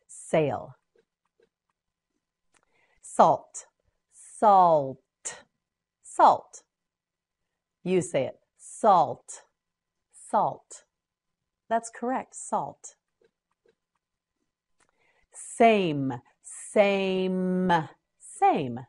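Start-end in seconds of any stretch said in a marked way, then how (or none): 4.1-5.0 "Salt" stretched, final t set apart; slow modelling. Shown as a demonstration. Teacher models word slowly. 16.4-17.7 "Same" drawn out, long final m; slow modelling. Shown as a demonstration. Teacher models word slowly.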